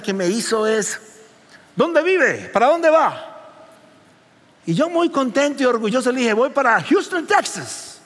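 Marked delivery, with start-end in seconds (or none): 1.8-3.4 lightly raised voice of a curious driver; a hint of the nosy taxi lady asking; taxi driver's question. Pastor voicing the taxi driver asking where he goes, lightly raised. 6.3-8.0 put-on Houston, Texas said in proud American English accent. Pastor proudly telling the taxi driver his destination in an American accent. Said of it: "Así, con acento que sonara bien increíble".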